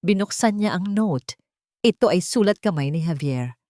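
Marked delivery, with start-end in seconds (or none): none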